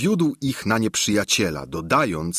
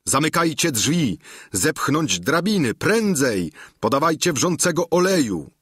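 krzyk; 6 semitones higher than usual. A narrator's reading. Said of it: "krzycząc przeraźliwie".

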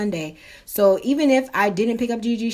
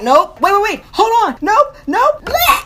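high, loud frantic shouting, building; mock panic; 12 semitones higher, 8 dB louder than usual. Sick woman stopping the man at the bathroom door, mock panic. Panic before throwing up, acted.

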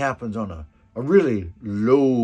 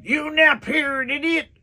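much higher, loud mock yell; cook yelling; 13 semitones higher, 5 dB louder, slower than usual. The cook swinging the stick at him, much higher loud yell. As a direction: bark it like the angry cook. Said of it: "and he's going".

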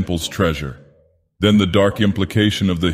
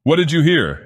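higher, snarling demand; snarled question; 9 semitones higher than usual. Acted. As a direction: bite the question out at him. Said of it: "fairly snarled".

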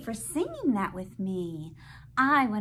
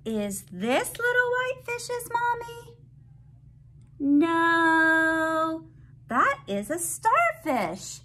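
bright, sing-song picture book reading; reading to a toddler; 8 semitones higher, 5 dB louder, slower than usual. Acted. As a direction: read it out like to a little one.

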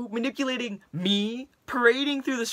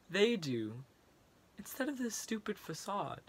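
much softer, broken and hushed; defeated, quiet; 9 dB quieter than usual. Acted. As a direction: sink into weary despair. Rant collapses into resignation.

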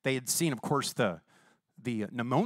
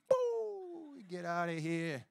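mock scaring boo; 3 semitones higher, 6 dB quieter, much slower than usual. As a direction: shoo the devil away playfully. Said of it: "we just went".

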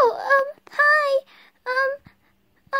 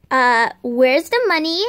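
stern mom voice; 12 semitones lower than usual. Pretend. Kid voices mom in pretend play.

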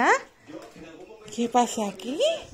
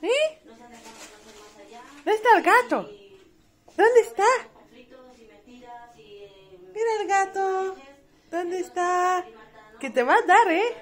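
high pet-talk voice; 6 semitones higher than usual. Real, not acted. Baby talk to the cat.

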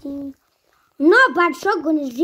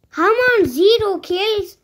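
loud, high, incredulous question; indignant disbelief; 6 semitones higher, 5 dB louder than usual. Real, not acted. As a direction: cry out in disbelief at the score. Shocked at own score.

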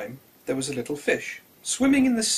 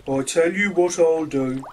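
slow, louder elephant voice; a big friendly elephant making an offer; kindly elephant; 2 semitones higher, 7 dB louder than usual. A narrator's reading. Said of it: "he said".

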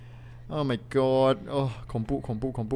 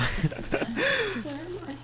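nervous laugh; 6 dB quieter than usual. Real, not acted. Nervous start of horror game.

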